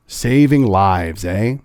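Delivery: slow, low sarcastic repeat of his phrase; sarcastic echo. Retold or imitated. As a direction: drag it out mockingly. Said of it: "Look at that rhetoric".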